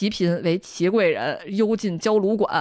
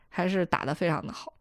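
whisper; 2 semitones lower, 7 dB quieter than usual. Real, not acted. Drops to a whisper.

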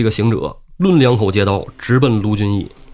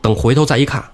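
ordinary delivery, nothing marked

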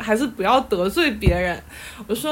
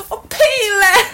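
higher and louder, a laughing scoff; 10 semitones higher, 5 dB louder, slower than usual. Real, not acted. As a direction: snort it out, half laughing. Scoffing at the astrologer's claim. (笑着不屑地骂一句)

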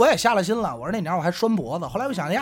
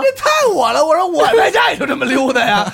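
excited; 8 semitones higher, 5 dB louder than usual. Real, not acted. Excited self-recognition, laughing.

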